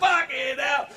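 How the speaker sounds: high, far-carrying curse; exasperated shout. Real, not acted. Comic remembering his blunder, shouting in exasperation. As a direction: blurt it out in disbelief. Exasperated outburst opening the bit.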